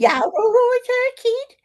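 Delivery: high-pitched, loud Brummie greeting; Brummie accent. Shown as a demonstration. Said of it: "in a Brummie accent".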